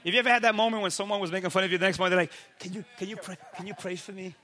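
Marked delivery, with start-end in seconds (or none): 2.6-4.3 softly mimicking a timid, halting plea; sheepish request. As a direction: ask under your voice, almost embarrassed. Mocker now sheepishly asking for prayer.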